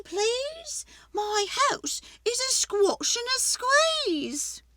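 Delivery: put-on high, loud complaining old lady; fretful old lady. Old lady begging the wise man for help, high and pleading.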